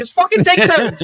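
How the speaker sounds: high, loud acted-out shout; retold yelling. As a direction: yell it as a quoted order. Acting out shouted orders.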